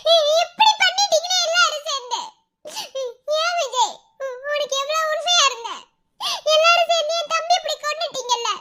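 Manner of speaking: high-pitched tearful accusation; anguished fury. Acted. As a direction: swear revenge through grief and rage. Sister accuses her brother's killers. (அழுகையும் ஆத்திரமும் கலந்த உயர்ந்த குரல்)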